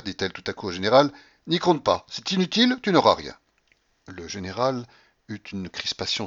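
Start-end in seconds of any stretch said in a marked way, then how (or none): 1.5-3.3 high, loud dismissal; imperious refusal. Acted. Grandmother refuses the general any money, imperious. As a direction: refuse him outright, loudly.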